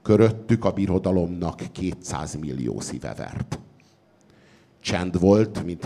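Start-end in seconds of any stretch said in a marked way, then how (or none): none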